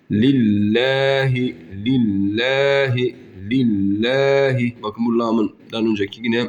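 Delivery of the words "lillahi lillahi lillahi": billahi with a light thin l, lahi near læhi; thin lam. Shown as a demonstration. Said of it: "harfin harekesi ince". (billahi ince okunuşla)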